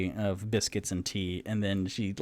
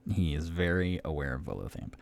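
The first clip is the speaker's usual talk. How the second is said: ordinary delivery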